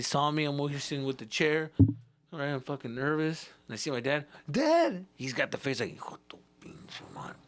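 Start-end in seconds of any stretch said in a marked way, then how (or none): 4.5-5.1 high, louder cry of relief; a lost kid spotting his father; relieved kid. Retold or imitated. Kid seeing his dad. 6.0-7.4 unvoiced furious whisper; silent curse. Dad's face cursing silently.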